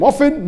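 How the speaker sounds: OFTEN without any T sound; silent T. Shown as a demonstration. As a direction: say often as "offen". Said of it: "Some say T is silent".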